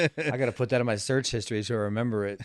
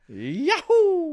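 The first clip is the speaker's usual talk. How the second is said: high, loud Mario cheer; Mario yahoo; 4 dB louder than usual. Retold or imitated. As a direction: shout it like Mario jumping. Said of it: "fake Italian accent he was doing".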